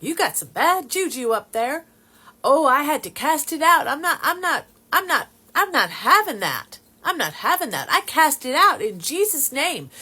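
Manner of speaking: high, loud imitated Texas accent. Texan cousin casting out bad juju, loud imitated Texas accent. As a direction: belt it out like a fired-up Texan cousin. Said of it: "she's saying it in her Texas accent".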